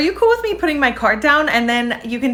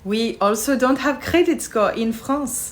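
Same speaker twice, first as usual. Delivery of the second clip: put-on French accent. Frenchwoman explains France to American tourist, French accent. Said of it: "a very impressive French accent".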